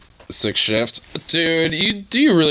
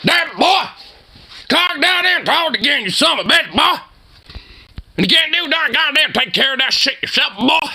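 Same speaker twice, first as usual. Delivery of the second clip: mock stepdad shouting at the kid; angry stepdad impression. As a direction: rant like a furious stepdad over a clogged toilet. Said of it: "He's like".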